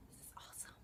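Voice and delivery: soft whispered repeat, almost silent. Real, not acted. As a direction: hush it like a secret echo. Whispered echo of own words.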